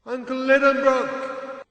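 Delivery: high, slow call into the dark. A narrator's reading. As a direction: call his uncle's name across a great distance. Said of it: "I called out".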